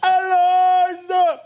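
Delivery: long, high drawn-out call; hammed-up high holler; mock yelling. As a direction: yell for a lost dog, stretching the name right out. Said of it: "having to yell out".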